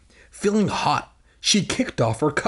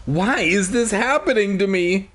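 higher, mildly distressed cry; understated scream in a raised pitch; 6 semitones higher than usual. A narrator's reading. Willa finding the teddy bear, mild scream. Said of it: "she screamed".

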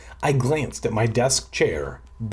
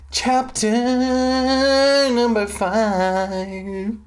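dramatic announcement; 11 semitones higher, much slower than usual. Pretend. Announcing chapter mock-dramatically.

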